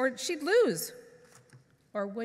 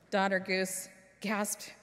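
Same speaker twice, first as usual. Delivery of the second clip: plain reading voice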